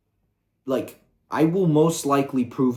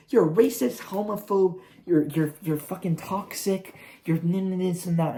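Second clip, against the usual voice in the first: higher, whiny mock of an outraged accuser; 9 semitones higher, 3 dB louder than usual. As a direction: parody the angry online crowd stammering accusations. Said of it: "Now it's like".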